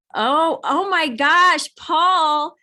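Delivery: thrilled to spot a friend; delighted surprise. Real, not acted. Guest joins from a cruise ship.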